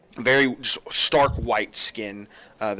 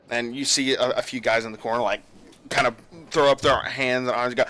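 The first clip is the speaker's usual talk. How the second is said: normal talking voice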